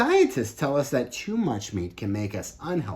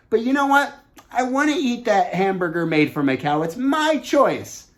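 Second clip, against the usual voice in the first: much higher and louder, defiant and insistent, slowing on my choice; stubborn meat-eater; 10 semitones higher, 8 dB louder than usual. Pretend. Speaker pretends to be a stubborn hamburger eater, defiant mock insistence. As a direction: play a selfish kid digging in their heels. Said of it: "That means if I said".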